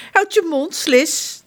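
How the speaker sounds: high, irritated retort; snapping prince. Acted. Prince John telling Slis to shut up, snapping. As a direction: snap at the adviser.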